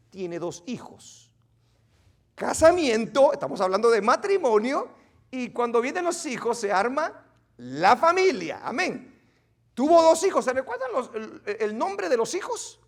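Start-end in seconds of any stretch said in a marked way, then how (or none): 2.3-12.3 loud fervent preaching. Acted. Teacher preaching on marriage and family, loud and fervent. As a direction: proclaim it from the pulpit.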